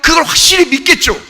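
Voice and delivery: high, driving sermon voice; forceful preaching. Real, not acted. Preacher to congregation on true belief, forceful. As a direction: press it home to the congregation.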